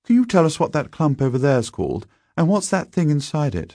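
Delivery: high schoolboy voice, politely asking. A narrator's reading. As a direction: pitch up as an inquiring boy. Narrator voices Algernon.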